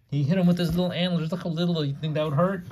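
high, fast amused comment; playful exclaim. Real, not acted. Parent playfully mocks little antlers.

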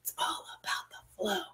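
very soft, hushed whisper. Pretend. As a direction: whisper it like a playful secret. Playful whispered repeat for effect.

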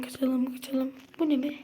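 ordinary talk, nothing marked

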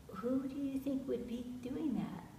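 hushed question. Real, not acted.

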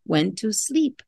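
usual speaking voice

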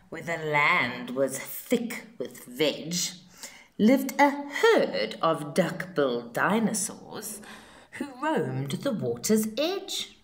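ordinary delivery

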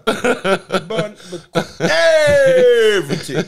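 slow, loud, laughing through it; laughing punchline. Real, not acted. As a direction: stretch it out, cracking up.

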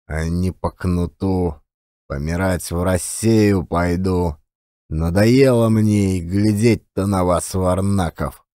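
put-on a weary old man brushing off the question; lightly voiced old man. A narrator's reading. Old marker telling the regulars off, low weary scorn.

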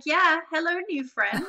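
loud, high acted delight; hammed-up keen hello; eager greeting. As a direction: greet total strangers like instant pals. Acted keen reply to strangers.

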